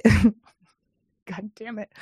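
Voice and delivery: laughing through a curse. Real, not acted. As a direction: let the laugh spill into it. Laughs at own stumble.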